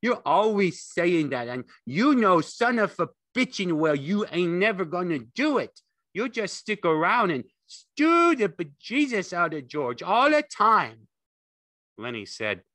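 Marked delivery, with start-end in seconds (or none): 0.0-11.1 high, loud nagging aunt; sharp scolding. A narrator's reading. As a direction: berate him without letting up. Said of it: "she said sharply".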